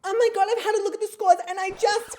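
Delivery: very high, loud mock wail; hammed-up near-crying. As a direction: wail in fake disbelief as if about to cry. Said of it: "I'm gonna cry".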